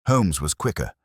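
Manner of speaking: ordinary delivery, nothing marked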